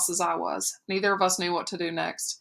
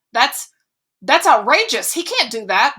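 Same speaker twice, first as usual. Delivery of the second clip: outraged; 4 semitones higher, 8 dB louder than usual. A narrator's reading. Mother outraged at news.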